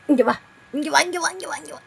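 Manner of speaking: quick, repeated come-here; coaxing call. Real, not acted. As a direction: call a pet over in a rapid chant.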